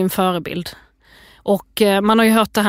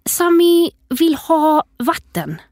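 put-on high, slow little-child voice; imitated toddler; 7 semitones higher, much slower than usual. Mother imitating her son asking for water at bedtime, high and slow. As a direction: plead slowly in a high toddler voice. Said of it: "Så han bara".

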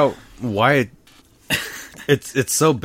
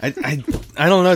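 laughing; 5 semitones higher, 3 dB louder, faster than usual. Real, not acted. Laughing, flustered over rating.

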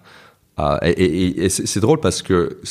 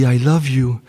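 higher and louder, overjoyed; delighted; 5 semitones higher, 4 dB louder than usual. Retold or imitated. Father repeating his toddler's words, overjoyed. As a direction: beam as you repeat the words. Father thrilled son said I love you.

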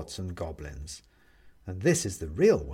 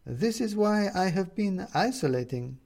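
put-on higher and slow, a careful older woman; Renata's voice; 9 semitones higher than usual. A narrator's reading. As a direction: let the woman speak higher and slowly. Renata speaks in the story.

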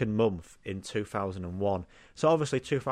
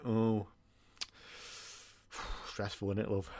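much softer, sighing concern; mock sympathetic host; 3 semitones lower, 10 dB quieter than usual. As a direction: coo fake sympathy at the contestant. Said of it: "just like".

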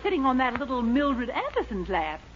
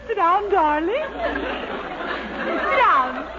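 flirty coo; 7 semitones higher, much slower than usual. Acted. Miss Baker flirts with Dennis.

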